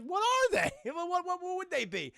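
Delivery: high-pitched, stammering disbelief; hammed-up incredulous squawk; mock incredulity. Acted incredulous reply about zombies.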